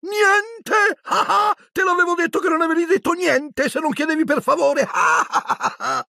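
triumphant trickster mocking the caretaker; cackling poltergeist. A narrator's reading. Peeves mocking Filch, cackling. (Peeves trionfante che sghignazza)

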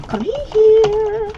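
high, loud, drawn-out squeal; playful taunting call. Pretend. Player chasing an opponent, playful loud squeal.

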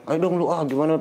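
mock nagging. Mimics partner nagging after mistakes. (menirukan partner mengomel, nada naik)

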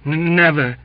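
loud, high stammer; agitated, stuttered denial. A narrator's reading. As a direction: stumble on the first sound. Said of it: "he stammered".

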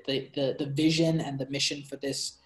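ordinary delivery

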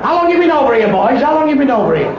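loud, high call. Acted. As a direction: call it out across the pub. Loud quoted question in joke.

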